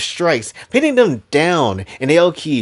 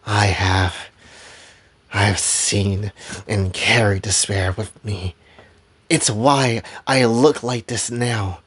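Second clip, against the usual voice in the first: lower, halting, heavy delivery; sombre confession; 6 semitones lower, 4 dB quieter than usual. Acted. Fighter admits she carries despair, sombre and subdued. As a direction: drop down after the outburst, pausing gravely. Grim confession after the outburst.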